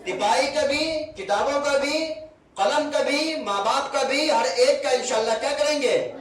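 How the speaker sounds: teacher leading a class pledge. Acted. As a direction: chant the list so the kids join in.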